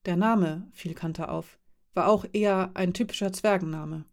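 usual speaking voice

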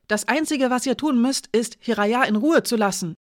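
put-on a scared girl shouts, defiant; angry shout. A narrator's reading. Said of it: "rief sie wütend". (wütend und hoch rufen)

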